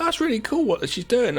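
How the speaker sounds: high, delighted admiration; impressed. Retold or imitated. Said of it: "I was like".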